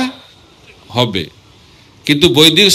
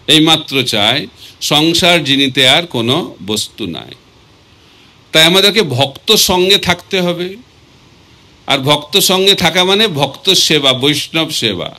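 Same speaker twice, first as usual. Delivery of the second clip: ordinary talk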